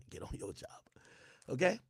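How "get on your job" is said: soft, low whispered catchphrase; whispered quote. Real, not acted. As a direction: whisper the line under your breath. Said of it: "if I'm whispering, it's because voice".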